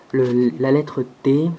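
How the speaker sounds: ordinary delivery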